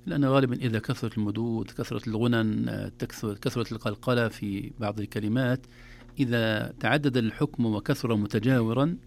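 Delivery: ordinary talk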